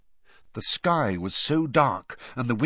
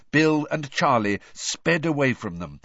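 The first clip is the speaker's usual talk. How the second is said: ordinary delivery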